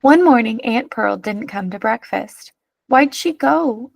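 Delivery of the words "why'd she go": tearful. A narrator's reading. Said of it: "asked Dan tearfully".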